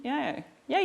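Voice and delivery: normal talking voice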